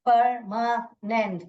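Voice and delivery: परमानेंट slowly split as पर, मा, नेंट; syllable by syllable. Shown as a demonstration. Teacher counting syllables for students, परमानेंट split slowly into beats. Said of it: "तो यहाँ पर कितने?".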